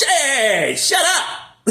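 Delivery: high, loud, dismissive shut up; hammed-up rebuke; mock God telling off. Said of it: "He just told him".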